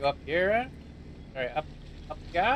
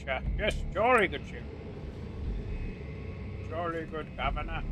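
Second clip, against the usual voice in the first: mock British accent; 4 dB quieter than usual. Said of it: "That is not how we talk".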